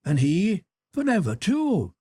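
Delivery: a teasing sister scolding her brother; young Sybil, gently scolding. Acted. Sybil to her jealous brother, playfully scolding.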